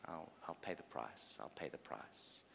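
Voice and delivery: low, soft self-offering; hushed, low promise; quiet resolve. Acted. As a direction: drop to a near murmur, accepting the cost. God quietly answers Satan's taunt.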